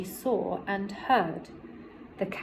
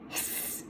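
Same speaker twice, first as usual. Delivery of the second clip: a breathy whispered hiss like a goose; 16 dB quieter than usual. Acted. The goose hisses a warning, voiceless and soft. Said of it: "said the goose".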